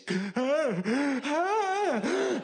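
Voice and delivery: sharp high gasp for air; mock gasp. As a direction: gasp like you can't breathe. Judgment Day scene.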